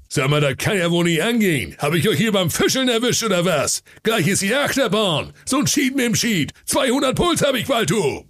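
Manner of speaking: thundering angry king, rising to a shout; roaring sea king. Acted. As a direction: bellow like thunder, building. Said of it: "Er brüllte mit einer Stimme wie Donnerhall". (brüllender Seekönig)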